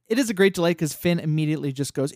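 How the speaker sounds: ordinary talk, nothing marked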